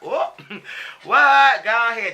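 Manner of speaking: high, loud whoop breaking into a laugh; squealing delighted laugh at a good comeback; gleeful shock. Retold or imitated. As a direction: react like you just heard the read of the night. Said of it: "And I was like".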